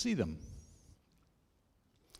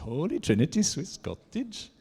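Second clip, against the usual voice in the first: light, high sing-song Norwegian accent; 8 semitones higher, 6 dB louder than usual. Shown as a demonstration. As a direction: say "Holy Trinity Swiss Cottage" in a mock Norwegian accent, pitched high. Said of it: "Maybe I should have done that with a Norwegian accent".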